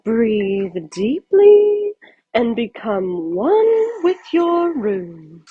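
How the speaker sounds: slow, soothing therapist drawl; calm therapist. Acted. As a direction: lull her like a guided meditation. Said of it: "she said".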